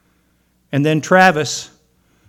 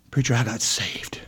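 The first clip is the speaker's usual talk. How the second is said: low, near-whispered news; hushed retold confession; 5 semitones lower, 10 dB quieter than usual. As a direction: act out a man quietly confiding it. Retold confession of being saved.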